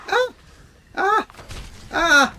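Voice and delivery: short, high, loud yelps; mock death yelps. Player's character being killed in game, yelping. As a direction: yelp with each hit. Screams as character dies in chair.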